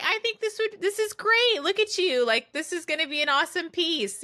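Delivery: high-pitched mock gushing; imitated excitement. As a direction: act out her excitement, voice lifted high. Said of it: "she was so excited".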